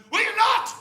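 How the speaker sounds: high loud snap, rising on not; furious retort. Retold or imitated. Angry woman to the comic, a loud high retort. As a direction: fling it back at him. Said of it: "She went".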